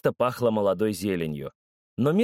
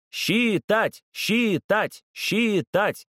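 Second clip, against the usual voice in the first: clamouring fans; 9 semitones higher, much slower than usual. A narrator's reading. Fans clamour at the judges, high chanted demand. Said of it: "загалдели болельщики".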